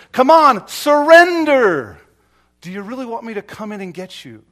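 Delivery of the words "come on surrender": higher, drawn-out call to surrender; God pleading. Acted. God calling the sinner to give up, pleading. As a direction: stretch "surrender" like a loving appeal. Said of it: "and he says".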